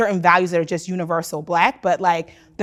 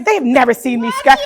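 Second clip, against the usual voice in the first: loud indignant; 5 semitones higher, 8 dB louder than usual. Retold or imitated. Same words repeated in retelling.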